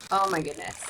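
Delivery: ordinary talk, nothing marked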